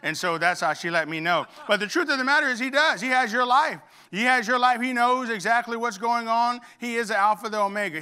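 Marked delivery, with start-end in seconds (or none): 1.7-8.0 high, loud, emphatic preaching; preaching at full force. Acted. Pastor assuring the church God has their life, in full preaching voice. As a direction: drive home the promise. Preaching climax on God's care.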